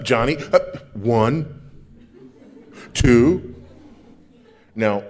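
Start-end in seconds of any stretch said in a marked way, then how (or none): 0.0-3.5 louder, warning count with a laugh; parent counting at child. Retold or imitated. Parent counting to Johnny, loud half-laughing warning. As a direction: drag out the count like a fed-up parent.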